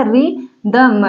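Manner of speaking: Rhythm drawn out slowly in two parts; syllable by syllable. Shown as a demonstration. Teacher shows how it is written, rhythm slow and split. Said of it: "rhythm कैसे लिखा जाएगा?".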